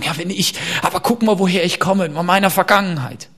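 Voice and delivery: mock excuse. People excusing themselves by their past.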